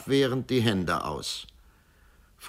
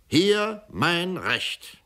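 slow triumphant; 11 semitones higher, much slower than usual. Acted. Executioner shows the paper.

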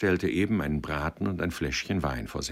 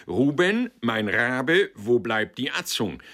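much higher, louder call across the room; calling the waiter; 7 semitones higher, 6 dB louder than usual. Acted. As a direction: bellow for the food, jovially. Director calls for food.

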